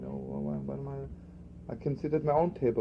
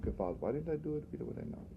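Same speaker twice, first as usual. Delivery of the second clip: softer, fading to a near whisper; voice of regret; 9 dB quieter than usual. Retold or imitated. Rabbi voicing the soul's regret to students, nearly whispered. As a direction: let the regret sink almost to a whisper. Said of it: "sometimes we hear that voice".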